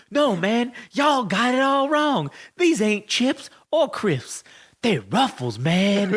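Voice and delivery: laid-back wrestler setting everyone straight; R-Truth impression. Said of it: "then he's like".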